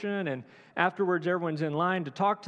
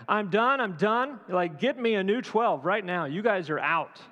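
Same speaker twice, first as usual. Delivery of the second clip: higher and louder, mock firing them all; hammed-up exasperated dismissal; fed-up rant; 4 semitones higher, 4 dB louder than usual. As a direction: pretend you've had it with the twelve. Imagined Jesus firing disciples.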